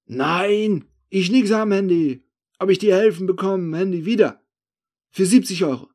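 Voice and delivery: high, loud broken-German haggling; insistent thief. Acted. Thief denying and offering paid help, insistent. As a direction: insist loudly while pushing your price.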